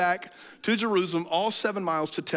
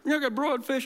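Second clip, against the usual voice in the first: much higher, bright ask for fish; mock Jesus; 8 semitones higher than usual. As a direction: pitch it up as a comic punchline. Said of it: "He's like".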